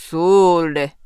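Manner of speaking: low curt samurai; gruff samurai. A narrator's reading. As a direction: hand it over gruffly. Samurai hands over candy.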